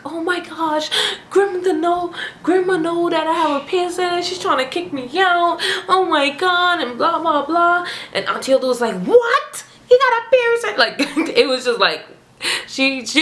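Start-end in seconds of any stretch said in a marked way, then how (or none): 0.0-7.9 high-pitched, panicky imitation of crying; mock crying. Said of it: "I was crying to them". 9.0-10.8 mock astonished shriek, very high; shocked aunt. Aunt's shocked reaction acted out.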